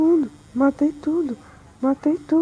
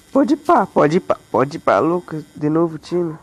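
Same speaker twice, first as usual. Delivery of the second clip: ordinary talk, nothing marked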